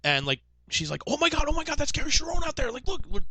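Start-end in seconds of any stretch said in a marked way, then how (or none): 1.1-3.2 high-pitched fangirl squeal of words; imitated starstruck excitement. Friend in the car spots a singer outside, excited. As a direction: gush like someone spotting a star.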